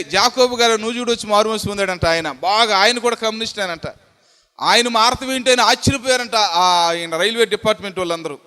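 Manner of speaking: ordinary delivery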